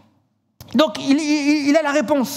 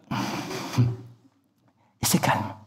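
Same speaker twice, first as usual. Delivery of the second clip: slow breathy whisper of calm; drawn-out hush; 10 semitones lower, 9 dB quieter, much slower than usual. Acted. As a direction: whisper it as if the house sleeps. Evoking the silent sleeping house.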